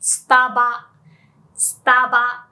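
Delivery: Starba as sutaba, cut after the ba; loud, clear Starba with Japanese vowels; Japanese short form. Shown as a demonstration. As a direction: demonstrate Starba as two punchy taught takes. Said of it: "or more commonly".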